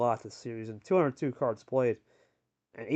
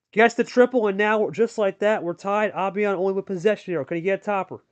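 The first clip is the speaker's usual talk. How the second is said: brisk, raised commentator call; live play-by-play; 5 semitones higher, 5 dB louder than usual. Acted. Commentator calling the match for viewers, lively play-by-play. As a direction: call every card as it lands.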